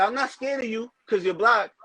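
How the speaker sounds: customer taunt. Retold or imitated. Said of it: "I said".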